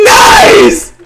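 high, loud laughing shout; shrieking laugh. Real, not acted. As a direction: cackle with delight. Screaming laugh.

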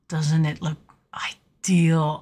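slow breathy whisper of wonder; whispered awe; awed whisper. Real, not acted. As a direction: hush it like a secret treasure. Gasps at the backdrop.

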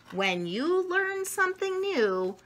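plain reading voice

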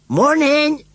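high, loud cheery-hail shout; shouted greeting. A narrator's reading. Scarecrow greets the children, shouted. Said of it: "shouted Gummidge".

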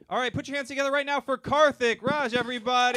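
big, loud, high host intro; host announcement. Acted. Host calls the next comic up, loud and high. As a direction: hype up the crowd for the comic.

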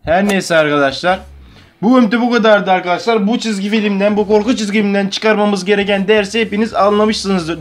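rally the audience like a cheerful host wrapping up; upbeat vlog outro. Acted. Energetic outro to viewers.

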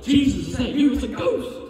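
high, alarmed cry of a startled disciple; high scared voice; Peter's frightened cry. Acted. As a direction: call out in fright from the boat. Voicing Peter's fearful cry.

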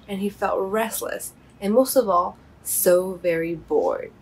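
plain reading voice

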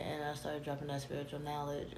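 normal talking voice

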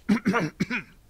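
loud hacking cough in character; acted coughing fit. A narrator's reading. Banker interrupted by his own cough, acted coughing fit. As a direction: cough hard into a handkerchief. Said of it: "and coughed into his handkerchief".

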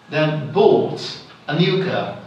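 "bought" as the main beat; stress on bought. Shown as a demonstration. Teacher testing students, emphatic stress on "bought". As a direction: make "bought" stand out from "a new car". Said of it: "I am emphasizing the word".

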